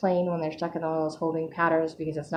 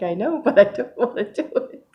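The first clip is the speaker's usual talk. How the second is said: mock childish whine, pitched up; reluctant protest; 4 semitones higher, 4 dB louder than usual. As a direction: laughingly whine back in protest, higher. Acting out her protest to God.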